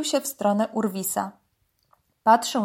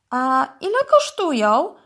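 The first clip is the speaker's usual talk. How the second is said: lightly curious question, higher and louder; 5 semitones higher, 5 dB louder than usual. A narrator's reading. Curious dog asks the python the price, inquisitive. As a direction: ask with eager interest. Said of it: "spytał zaciekawiony pies".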